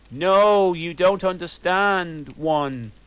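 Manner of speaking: loud pleading no, fading; mild mock scream. Draco pleading with his friends, mock scream. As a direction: plead with them, half-hammed. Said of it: "screamed Draco sadly".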